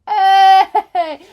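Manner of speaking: loud, high drawn-out hey; playful shout. Acted. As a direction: belt out a cheeky, teasing hey. Playful outburst after a joke.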